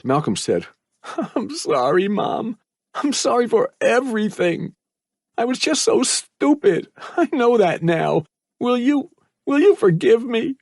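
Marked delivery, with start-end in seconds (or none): 1.4-10.6 put-on high, tight, tearful boy's apology; strained voice. A narrator's reading. Son apologises to his mother, strained. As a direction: squeeze the apology out. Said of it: "In a strained voice".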